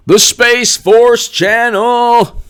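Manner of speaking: high, drawn-out call; excited shout-out. Real, not acted. Streamer hailing a viewer's channel, excited shout-out. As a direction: sing out the name slowly, way up high.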